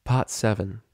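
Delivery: usual speaking voice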